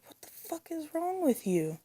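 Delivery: high-pitched shocked disbelief. Retold or imitated. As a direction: squeak it out, appalled. Outraged at filming corpse.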